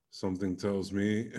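ordinary talk, nothing marked

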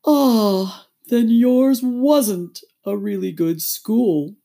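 high, loud, drawn-out relief; slow turtle voice of great relief; relieved Mock Turtle. A narrator's reading. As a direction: sigh it out, relieved. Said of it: "a tone of great relief".

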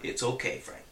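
imitating a soft, nonchalant, half-whispered reassurance; almost whisper it, calmly and unbothered.

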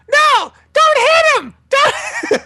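very high, loud panicked squeak; high squeaky voice. Retold or imitated. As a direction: shriek it like a scared cartoon mouse. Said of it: "the older brother was like this".

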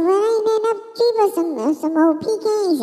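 a high, strange dog drawling about pecans; other dog voice. Acted. Other dog explains the tree, high and slowed. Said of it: "the other one said".

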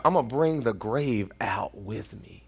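unvoiced, hushed promise; dramatic whisper. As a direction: whisper it like a triumphant secret. Dramatic quote of Jesus.

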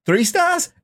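high-pitched mock disbelief; squeaked, questioning disbelief; incredulous. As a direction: shoot up high in scoffing disbelief. Acting out own disbelief at rating.

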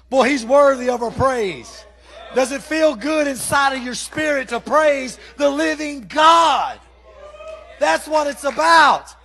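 loud, slow fiery preaching. Acted. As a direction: shout it like a revival preacher. Preaching at full force.

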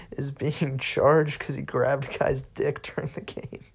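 barely holding in the laugh; talking through giggles. Real, not acted. Cracking up at soccer story.

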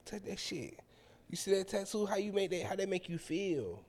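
soft, teasing come-on in imitation; mock-sultry quiet voice; seductive woman. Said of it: "She said".

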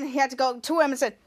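ordinary delivery, nothing marked

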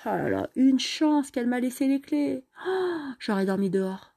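relieved cry about the keys; relieved exclamation. Acted. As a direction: breathe out in relief. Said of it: "Alicia s'écrie".

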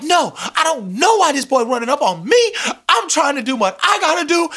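high, indignant complaining voice; huffy Luther. Retold or imitated. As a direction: whine it like a diva offended by a stranger. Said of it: "He's like".

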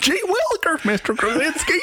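very high laughing gee-whiz voice; wholesome 1950s kid. Retold or imitated. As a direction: shout it like an eager farm boy. Repeats the kid bit.

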